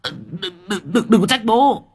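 high, stammering bluster; stuttered high-pitched threats; tough talk. A narrator's reading. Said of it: "nói cứng".

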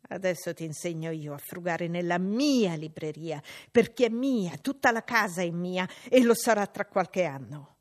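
put-on loud, jeering young tyrant; bullying boy. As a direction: lord it over the poor cousin. John Reed's voice.

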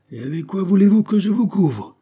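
high, loud exasperated shout; captain's indignant outburst; exploding. A narrator's reading. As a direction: blow up at an impossible demand. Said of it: "explosa Fourgue".

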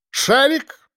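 high-pitched summons; calling the dog. A narrator's reading. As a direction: call out to your dog. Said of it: "позвал Архип". (подзывая собаку, высоким голосом)

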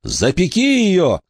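high-pitched, desperate command; urgent healer's cry. A narrator's reading. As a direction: cry it out high without much added volume. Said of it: "крикнул он".

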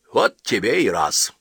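mock dismay. A narrator's reading. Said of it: "шутливо развел руками".

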